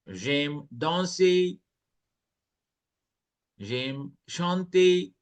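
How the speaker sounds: "danser" and "chanter" drawn out slowly; slow, careful model reading; slow clear French. Shown as a demonstration. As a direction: read each French phrase slowly for learners.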